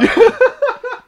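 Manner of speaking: sharp high laugh; loud laugh. Real, not acted. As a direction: laugh out at your own joke. Laughs at own joke.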